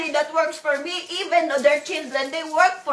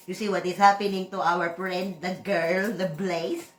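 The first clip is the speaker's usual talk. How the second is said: ordinary delivery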